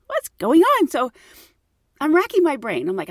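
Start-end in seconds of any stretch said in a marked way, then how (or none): 0.1-0.9 high, louder baffled What's going on; bewildered cry. Retold or imitated. Stunned at a stranger knowing her home, high bewildered cry. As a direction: pitch shoots up in disbelief.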